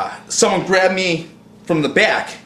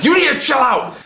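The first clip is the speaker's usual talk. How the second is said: put-on high, raised yell to calm a girl down; yelled order; 8 semitones higher than usual. Yelling at the girl during the street fight, a high raised yell. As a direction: snap at her to chill out, pitched up. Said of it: "and I was like".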